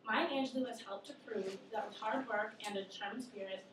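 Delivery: ordinary talk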